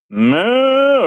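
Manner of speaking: a high mock cow moo. Mocking rivals as a herd, high mock moo. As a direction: moo at them like cattle.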